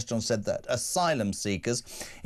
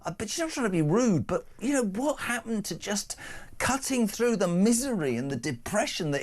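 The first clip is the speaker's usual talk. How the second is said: animated rant; 9 semitones higher than usual. Real, not acted. Ranting about lost banter.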